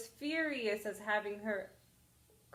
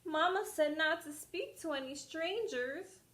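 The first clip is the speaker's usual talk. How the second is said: put-on little girl voice; 7 semitones higher than usual. Girl answers the wolf.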